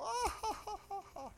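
high, fading "ah, ha, ha" mimicking the driver; imitated mocking laugh. Driver laughing at the man who prayed, imitated high laugh. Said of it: "And he'd just go".

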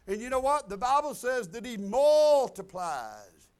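Southern revival preaching, slow and loud. Acted. Preaching at full force.

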